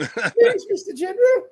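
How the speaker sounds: put-on very high British child voice; squeaky-high English accent; mock English kid. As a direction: mimic a pleading little English boy. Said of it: "Another English sounding kid".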